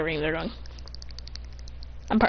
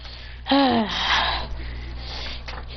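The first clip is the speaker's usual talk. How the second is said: long, soft, weary exhale; real sigh; 5 dB quieter than usual. Real, not acted. Speaker fed up with dying controller.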